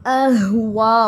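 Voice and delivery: अह वा very slow and loud, ह from the chest. Shown as a demonstration. Teacher showing voice from the chest, slow and loud. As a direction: push अह वा from the chest. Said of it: "सीने से आवाज़ आनी चाहिए".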